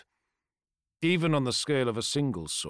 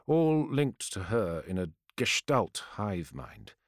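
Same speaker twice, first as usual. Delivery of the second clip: usual speaking voice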